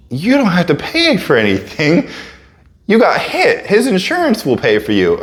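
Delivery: retold exasperated reassurance; incredulous insistence. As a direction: spell it out, high and emphatic, in mock disbelief. Acted medics answering his cost worry.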